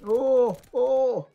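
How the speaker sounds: two loud, high ohs; excited exclamation. Real, not acted. Player in sudden action, excited cries. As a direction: burst out twice, high and loud.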